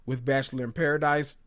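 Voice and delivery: ordinary talk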